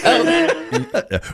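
high real laughter. Real, not acted. Host laughing at the rag detail. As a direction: burst out laughing.

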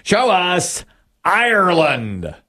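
suspenseful host's reveal; game-show reveal call. Real, not acted. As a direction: call to the board, stretching the answer. Host calls for answer reveal.

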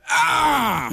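high, drawn-out cry of dismay; comic high-pitched letdown wail; mock wail. Shopper realising it was a sales pitch, wailing in mock dismay.

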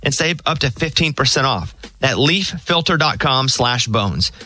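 upbeat ad read, slowing way down on the web address; host reading a sponsor spot, crisp commercial delivery; live ad read. Acted.

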